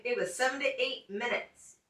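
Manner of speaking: ordinary talk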